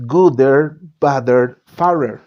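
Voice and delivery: gooder, badder, fairer said as the wrong regular forms ending in -er; wrong irregular forms. Shown as a demonstration. Teacher showing students the wrong -er forms. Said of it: "en inglés eso no es correcto".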